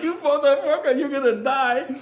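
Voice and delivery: high, strained comic rage; retold furious threat. Driver grabbing the baboon in a fight, furious mock threat. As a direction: threaten it in high, laughing fury.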